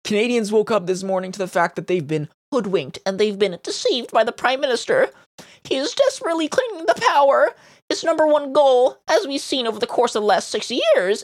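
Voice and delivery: very high, whiny mocking voice; mock crying outrage. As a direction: read it as a squeaky, hammed-up complaint. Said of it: "they're crying and screaming and they say".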